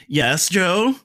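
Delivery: high, chirpy smart speaker reply; voice assistant. Pretend. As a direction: answer like Alexa. Answers as the Alexa device.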